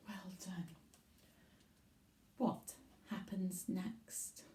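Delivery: soft unvoiced whisper; teacher's whisper. Real, not acted. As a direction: hush it like a secret for the class.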